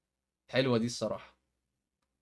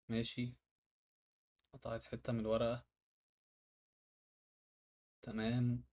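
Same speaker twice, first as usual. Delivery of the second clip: ordinary talk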